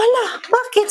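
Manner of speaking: sharp high startled cry; alarmed. Retold or imitated. To the mother after hearing the fever is high, a startled high cry. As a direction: jolt up in alarm.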